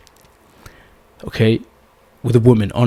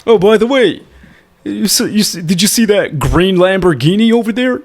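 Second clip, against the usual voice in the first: higher, eager show-off fishing for praise; bragging show-off; 6 semitones higher than usual. Shown as a demonstration. Coach mimics a guy bragging about his Lamborghini, eager. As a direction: show off the car and wait for her approval. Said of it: "You don't wanna be on a date like this".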